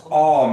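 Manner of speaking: aw, loud and drawn. Shown as a demonstration. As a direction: stress the ending aw loudly. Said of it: "make that T Kiowa".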